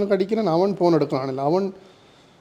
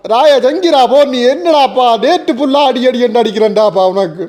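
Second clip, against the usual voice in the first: loud, high-pitched outburst; angry shouting; 6 semitones higher, 8 dB louder than usual. Acted. Caller berating a friend who ignored his calls, angry shout. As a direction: lay into him as soon as he picks up.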